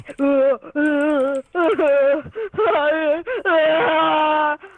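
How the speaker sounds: a crying, wailing laugh. Real, not acted. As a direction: laugh so hard it turns to a wail. Scammer laughing, crying sound.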